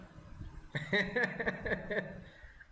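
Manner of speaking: high, breathy giggling run; real high-pitched laughter; hearty laugh. Real, not acted. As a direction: laugh helplessly at your own remark. Laughs at own accent comparison.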